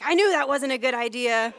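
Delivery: loud laughing I-told-you-so as dad; mock dad scolding through laughter; dad's told-you-so, laughing. Daughter retelling her dad's loud told-you-so. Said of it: "And he's like".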